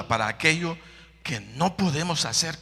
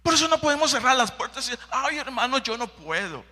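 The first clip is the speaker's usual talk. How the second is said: whiny believer; 12 semitones higher, faster than usual. Acted. Mocking a whining believer.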